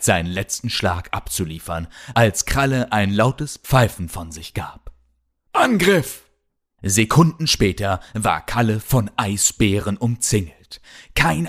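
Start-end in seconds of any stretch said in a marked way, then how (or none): 5.5-6.2 high-pitched call to attack; battle cry. A narrator's reading. Polar bears summoned to surround Kalle, calling out.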